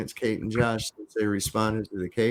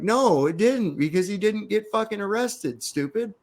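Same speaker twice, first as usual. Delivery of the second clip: pitched-up scornful comeback; high, sneering put-down; mocking retort; 8 semitones higher than usual. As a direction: talk down to him like he's dim. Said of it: "And it's like".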